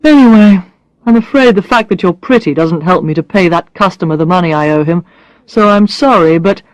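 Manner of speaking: a hint of a blunt man, teasing; Brad's voice. Brad teasing Alex about her looks, lightly voiced man.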